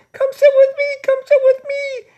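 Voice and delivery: put-on very high, loud girlish calling; little girl. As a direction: call out to a friend like an eager child. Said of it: "one little girl comes up".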